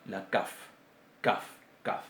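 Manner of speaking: "kaf" con k oclusiva seca. Shown as a demonstration. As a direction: demuestra "kaf" con golpe de k. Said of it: "ahí sí tenemos nuestra letra C". ("kaf" oclusiva bien marcada)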